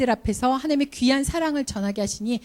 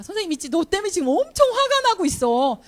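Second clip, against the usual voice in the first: raised, higher pitch scolding; mock angry teacher; 8 semitones higher than usual. Teacher warning a troublemaker she is angry, raised stern voice. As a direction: play the fed-up teacher warning the kid. Teacher confronts misbehaving child.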